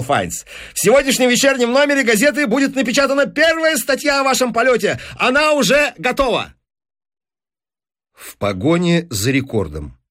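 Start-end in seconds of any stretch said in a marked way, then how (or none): 0.7-6.5 fast, high-pitched excitement; triumphant announcement. Acted. Businessman telling professor the article is ready, triumphant. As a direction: race through the news like a headline.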